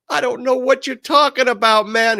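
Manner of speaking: high, loud yell across the street; retold shout. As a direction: yell it back at the gunman. Shouting back at gunman.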